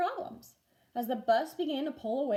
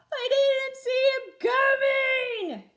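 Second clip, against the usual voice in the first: put-on high, loud, slow wailing boy; drenched boy's loud wail; 9 dB louder, much slower than usual.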